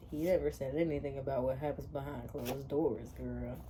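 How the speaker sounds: low soft suggestive tone; sultry whisper. Real, not acted. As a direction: purr it suggestively.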